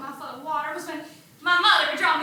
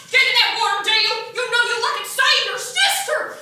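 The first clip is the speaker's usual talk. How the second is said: loud, raised-pitch barked orders; furious scream; 3 semitones higher, 5 dB louder than usual. Acted. Furious father screaming at Dale to save her sister, loud. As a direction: roar it at the child on the shore. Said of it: "started screaming at the top of his lungs".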